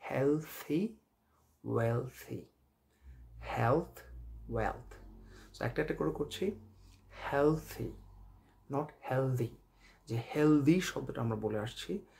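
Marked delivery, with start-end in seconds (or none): 0.1-2.4 careful model of হেলথি, ওয়েলথি; correct th sound. Shown as a demonstration. Teacher modelling the th in হেলথি for learners. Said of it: "থার মতো হবে". 9.1-9.6 হেলদি with a hard "d", the common wrong way; wrong-way demo হেলদি; wrong pronunciation. Shown as a demonstration. Teacher contrasting the wrong হেলদি. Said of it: "এটা ভুল উচ্চারণ".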